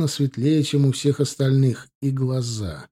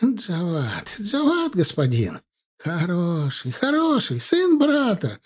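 high lamenting cries, much higher and slow at the end; wailing merchant; 6 semitones higher, slower than usual. Acted. Caravan man laments to the officer, high wailing pleas. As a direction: wail and plead over the nephew. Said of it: "запричитал караванщик".

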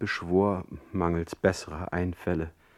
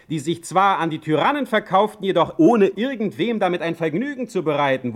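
high, loud, sneering lecture; mocking scorn; 8 semitones higher, 5 dB louder than usual. Heine taunting the offended visitor, mocking and lofty. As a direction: pile on the ridicule with relish. Heine mocks Stege's chivalry.

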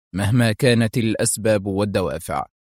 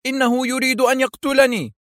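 much higher, desperate plea; crying for help; 14 semitones higher than usual. A narrator's reading. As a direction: call out to the police as if about to be killed. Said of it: "وهتف".

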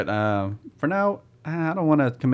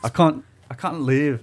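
put-on slow, higher English accent with broad "can't"; mock English accent; 4 semitones higher, 3 dB louder than usual. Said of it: "it'd be an English accent".

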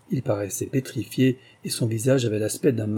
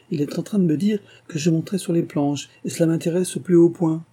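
a hint of an old marquise, higher and eager; lightly voiced excited marquise; 7 semitones higher than usual. Marquise tells the hostess she will act, eagerly. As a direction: voice the thrilled old lady, pitched up.

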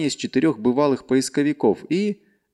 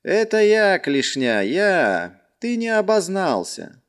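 reluctant; 8 semitones higher, slower than usual. Acted. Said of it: "ответил неохотно".

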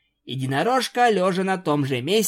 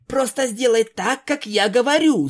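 higher, louder strict command; stern order; 8 semitones higher, 4 dB louder than usual. A narrator's reading. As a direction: bark the order at her. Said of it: "строго приказала Твайлайт".

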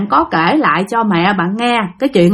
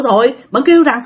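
usual speaking voice

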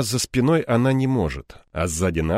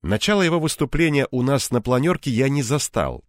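ordinary delivery, nothing marked

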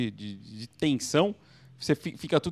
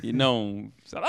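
ordinary talk, nothing marked

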